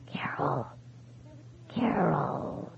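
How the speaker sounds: soft, breathy, trembling warning; whispery vibrating voice. A narrator's reading. Ghost warns his widow over the phone, whispery and trembling. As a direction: let the voice tremble and fade.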